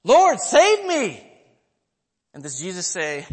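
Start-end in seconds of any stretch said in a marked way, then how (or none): 0.0-1.3 loud, high, drawn-out cry for help; desperate cry. Retold or imitated. Peter crying out to the Lord as he sinks, loud and desperate. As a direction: cry out slowly at full voice. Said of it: "He cries out".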